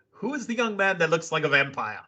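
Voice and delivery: lightly imitated British commentator; a hint of Regal's English accent; William Regal impression. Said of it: "William Regal, quote".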